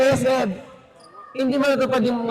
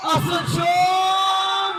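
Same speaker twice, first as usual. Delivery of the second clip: much higher, very slow drawn-out yell of the name; long yelled name; 8 semitones higher, much slower than usual. Real, not acted. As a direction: stretch the name out like a stadium call. Player name yelled after steal. (mahabang sigaw ng pangalan)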